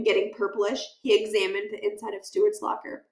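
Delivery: ordinary delivery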